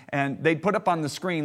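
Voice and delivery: normal talking voice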